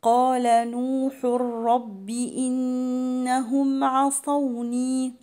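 "نوح رب" fused into a doubled r, no hum; merging without ghunnah. Shown as a demonstration. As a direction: chant "نوح" straight into a strong ر of "رب". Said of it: "إدغام بس من غير غنة".

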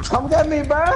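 high, urgent call for rescue; insistent high plea; pleading call. Real, not acted. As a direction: call out to teammates for help. Calls teammates for rescue.

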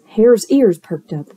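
ordinary delivery, nothing marked

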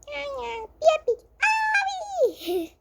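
high squeaky cute noises; cutesy squeal. Pretend. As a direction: squeal like a tiny pet. Cute animal noises for pet.

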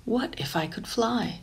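plain reading voice

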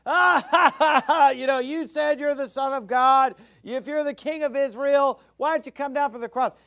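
high, loud sneering mockers' laugh; hammed-up taunting crowd; mocking jeer. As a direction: jeer at him like the crowd at the cross. Mockers jeering at crucified Jesus.

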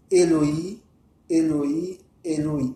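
"Eloi, Eloi, Eloi", first two rising, last falling; recited invocation. Shown as a demonstration. Teacher shows listeners what to say before riding, recited invocation. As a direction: say "Eloi" three times, separated, drawing up the end until the last drops. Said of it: "vous dites".

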